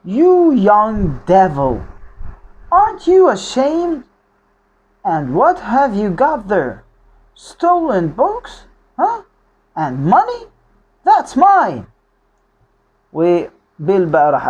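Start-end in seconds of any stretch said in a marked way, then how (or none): 0.0-11.9 slow, high-pitched accusing outburst; acted angry scolding. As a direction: confront the thief, questions swooping up high. Acts Bill accosting Oliver angrily. (توبيخ غاضب بنبرة عالية ممثلة)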